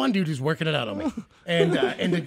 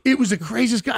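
normal talking voice

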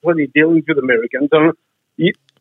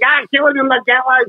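higher-pitched mock rant; mimicked protester; 7 semitones higher than usual. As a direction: lightly caricature a protester ranting on TV. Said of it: "Next time she gets on T V".

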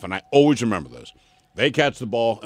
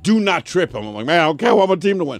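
louder, higher retold protest; retold argument; 6 semitones higher, 5 dB louder than usual. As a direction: push back insistently. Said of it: "I was like".

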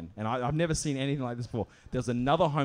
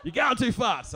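raised-pitch retold complaint; mock argumentative protest; accusing; 6 semitones higher than usual. Same accusation repeated in acted argument.

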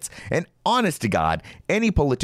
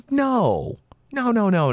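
emphatic no; 10 semitones higher than usual. Real, not acted. Emphatic refusal.

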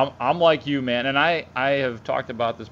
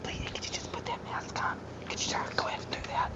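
whisper voice; 3 semitones higher, much faster than usual. Retold or imitated. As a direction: whisper it, sweet and submissive. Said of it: "when you do the whisper voice".

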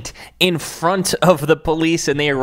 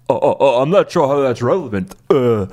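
mock stammering; 4 semitones lower, 4 dB louder than usual. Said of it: "when questioned".

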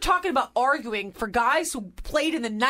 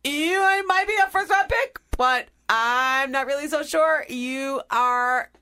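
high, loud sing-song taunt, easing down by the end; mock teasing warning; 3 semitones higher, 4 dB louder than usual. Acting out teasing pink-slip warning.

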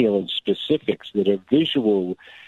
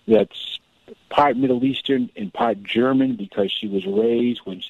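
ordinary talk, nothing marked

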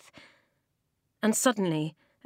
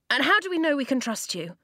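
higher, quick and louder challenge; suspicious boy; 8 semitones higher, 4 dB louder, faster than usual. Acted. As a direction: demand an answer from a stranger.

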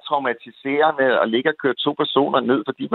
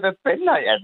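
raised, a retold half-shout at the cop; mock yell; 6 semitones higher, faster than usual. Caller retelling how he shouted at the cop, mild mock yell. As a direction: call it out, a bit angry. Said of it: "så råbte jeg".

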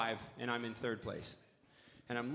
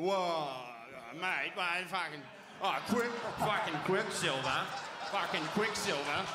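loud, higher mock Australian shout; Aussie guy; 5 semitones higher, 3 dB louder than usual. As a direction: yell it like an outraged Aussie fan. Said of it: "the Aussie guy started".

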